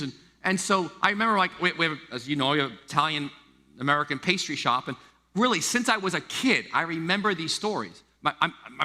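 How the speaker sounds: ordinary talk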